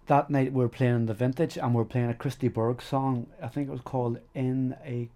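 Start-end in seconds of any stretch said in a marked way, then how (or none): none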